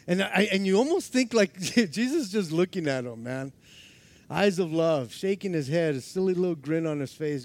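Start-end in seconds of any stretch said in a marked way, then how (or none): none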